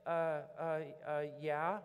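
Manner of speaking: halting, timid mock stammer. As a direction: stammer like a shy guilty girl. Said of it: "And she's like".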